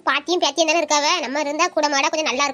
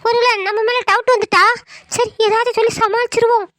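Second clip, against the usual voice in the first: put-on higher-pitched, hurt young girl pouring out her troubles; tearful young niece; 3 semitones higher than usual. Niece confiding her friend troubles to her uncle, higher tearful voice. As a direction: play her as a wounded teen close to tears.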